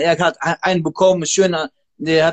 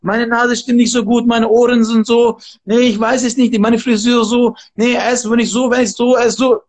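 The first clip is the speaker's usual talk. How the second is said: mock whining, pitched up and running on; whiny excuses; 5 semitones higher than usual. Trainer mocking hesitant people who find excuses, whiny and higher. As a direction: imitate people making excuse after excuse, higher. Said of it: "Viele denken".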